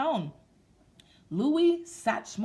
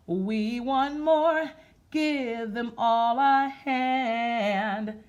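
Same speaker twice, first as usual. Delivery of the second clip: slow, sing-song rhythmic reading; read-aloud rhyme; 3 semitones higher, 6 dB louder, much slower than usual. Acted. Teacher reading a jazz picture book to kids, in a slow sing-song. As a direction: chant it like a nursery rhyme, slow.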